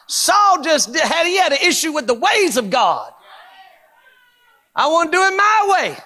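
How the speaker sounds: high-pitched, emphatic pulpit cry; impassioned preaching. Acted. Preacher exposing Saul's issue with God's ways, impassioned preaching. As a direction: drive each point home to the congregation.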